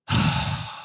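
long, voiceless exhale; exasperated sigh. Acted. Fare collector sighs at the clueless passenger, exasperated. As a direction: let out a weary huff. Clerk exasperated by feigned ignorance.

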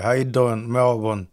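ordinary talk

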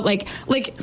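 ordinary talk, nothing marked

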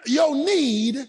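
forceful, high-pitched sermon delivery; impassioned preacher driving the point home; preaching. Real, not acted. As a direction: preach it hard to the congregation, stretching key phrases.

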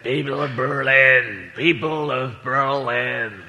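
slow, stately speech in a poor impression; President Kennedy. President addresses the crowd at the Berlin Wall, slow oratory. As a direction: stretch out each word like a grand orator. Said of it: "can't do President Kennedy as an impression".

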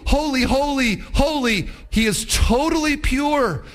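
high, rising shouted praise; preacher crying out holy, holy, holy; preaching at full force. Acted. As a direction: build the crowd up to worship. Climax of the sermon.

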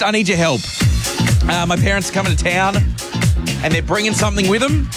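ordinary delivery

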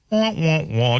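mock sad-trombone "wah wah wah"; sad trombone noise. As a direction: imitate a deflating trombone, mockingly. Mocking fading interest.

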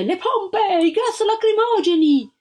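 high, loud shout over the chaos; shouted order. Acted. Voice in the crowd calls for hoses and tear gas, shouting. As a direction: bark the command to the troops. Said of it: "Si udì una voce".

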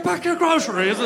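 high, loud mock British bark; exaggerated British impression; Winston Churchill. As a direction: order it in a hammed-up British voice. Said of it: "Winston Churchill was like".

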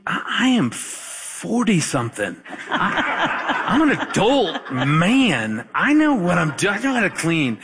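high, hammed-up offended protest; mock indignation. Husband retelling his comeback to wife's warning, mock indignation. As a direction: protest like an insulted grown man, half laughing.

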